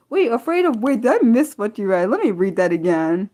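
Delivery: wait, I misread that!; startled realisation; sudden alarmed surprise. Real, not acted. Realises she misread comment.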